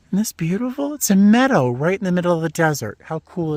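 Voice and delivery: high-pitched, quick burst of wonder; delighted marvelling. Real, not acted. Showing viewers a meadow in the desert, delighted marvelling. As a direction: marvel out loud, pitched well up.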